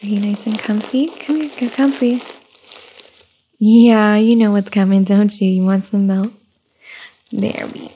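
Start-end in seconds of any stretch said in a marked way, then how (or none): none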